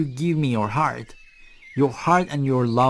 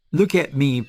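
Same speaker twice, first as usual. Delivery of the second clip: usual speaking voice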